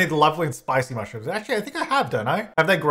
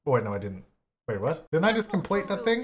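ordinary talk

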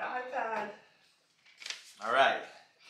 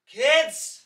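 yelling across the room; 10 semitones higher, 15 dB louder than usual. Real, not acted. Dad calling kids across house.

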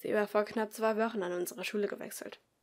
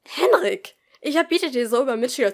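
sharp, louder scolding, higher; angry teacher; 3 semitones higher, 12 dB louder than usual. Acted. Teacher scolds Henrik in class, stern and loud. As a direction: scold the pupil firmly. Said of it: "Die Lehrerin schien wütend zu sein".

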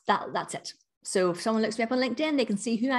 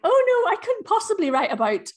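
dramatic worried voice; 13 semitones higher, 7 dB louder than usual. Retold or imitated. Mimicking anxious businesswomen.

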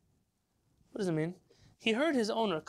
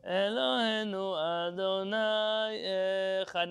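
slow, drawn-out chant of the verse; slow prayer recitation; 2 semitones higher, much slower than usual. Shown as a demonstration. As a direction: recite it slowly, word by word. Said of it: "They say".

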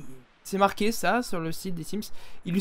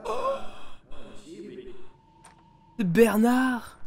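usual speaking voice

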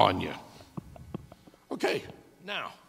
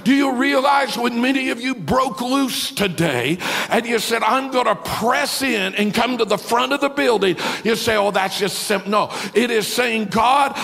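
louder, higher, driving preaching over music; preaching climax; 7 semitones higher, 6 dB louder than usual. Acted. Pastor preaching to a worshiping congregation, loud and climactic. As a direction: build the crowd into worship.

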